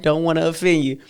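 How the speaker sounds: normal talking voice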